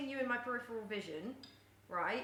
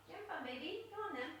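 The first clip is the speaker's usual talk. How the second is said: high, softer coaxing coo; soft pet talk; 7 semitones higher, 7 dB quieter, faster than usual. Real, not acted. Owner coaxes her cat Pochi, soft high coo. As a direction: coax the cat along gently.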